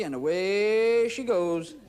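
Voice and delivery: stretched, slow showman's flourish; slow, drawn-out stage call; drawn-out send-off. Real, not acted. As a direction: send it sailing off on a long held word. Sending the drink off with flourish.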